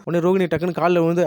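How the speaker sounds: ordinary delivery, nothing marked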